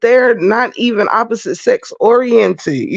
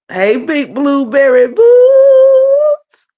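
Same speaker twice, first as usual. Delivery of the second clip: slow, high, louder sing-song greeting; drawn-out shout-out; 6 semitones higher, 4 dB louder, much slower than usual. Real, not acted. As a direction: stretch out the viewer's name like calling across a room. Greeting a viewer in chat.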